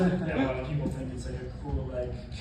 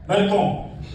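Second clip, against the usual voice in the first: louder, pointed slur in Spanish; loud accusing call; 5 semitones higher, 8 dB louder than usual. Retold or imitated. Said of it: "he says it again".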